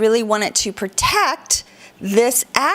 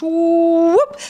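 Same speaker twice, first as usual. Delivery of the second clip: sliding whoop; 6 semitones higher, 3 dB louder than usual. Shown as a demonstration. Sound for the strait's path.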